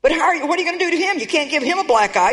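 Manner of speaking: ordinary talk, nothing marked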